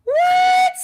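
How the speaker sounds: high, loud "what" of astonishment; shocked disbelief. Real, not acted. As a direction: react stunned to a wild theory, voice shooting up. Reacting to a startling theory.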